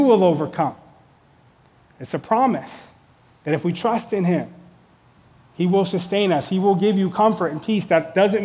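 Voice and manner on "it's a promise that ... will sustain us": ordinary talk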